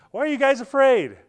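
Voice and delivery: high, loud disbelieving question; can't believe they're scared; incredulous. Acted. Jesus asking the terrified disciples in the boat, loud incredulous. Jesus asks amid storm.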